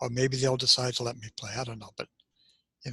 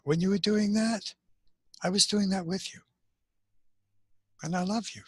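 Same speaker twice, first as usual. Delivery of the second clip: tender inner voice; 7 semitones higher than usual. Retold or imitated. Inner voice speaking lovingly.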